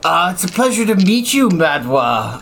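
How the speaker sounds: Dragonborn voice. Acted. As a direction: try a new Dragonborn voice. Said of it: "I'm trying to change my voice to a different Dragonborn".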